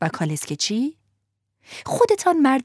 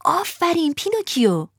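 cheering; 9 semitones higher than usual. A narrator's reading. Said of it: "فریاد زدند".

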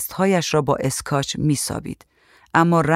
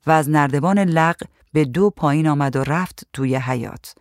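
plain reading voice

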